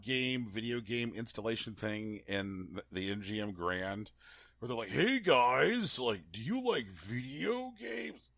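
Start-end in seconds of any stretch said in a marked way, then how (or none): 4.9-8.3 cheesy promoter voice. Retold or imitated. Impersonating arena staff greeting.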